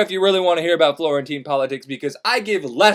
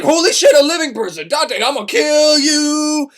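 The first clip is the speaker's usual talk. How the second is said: angry Minotaur; 8 semitones higher, 4 dB louder than usual. Acted. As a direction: bellow it out like a raging beast. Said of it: "the Minotaur is like".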